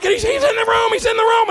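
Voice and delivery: high, loud frantic warning; alarmed shout. Retold or imitated. Improv partner shouts the killer is inside. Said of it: "And then he's like".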